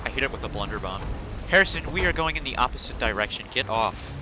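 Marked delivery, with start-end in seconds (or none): none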